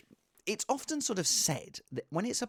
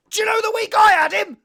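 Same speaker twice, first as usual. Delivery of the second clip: exasperated shout; 11 dB louder than usual. Real, not acted. Outburst recalling owning Kane.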